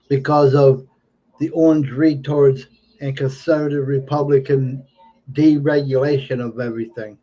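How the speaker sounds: normal talking voice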